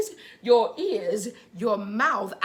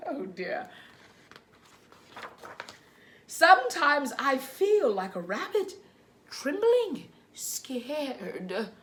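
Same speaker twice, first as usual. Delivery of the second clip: put-on reading to children; 3 semitones higher, slower than usual. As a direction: read gently to little ones. Reads picture book to children.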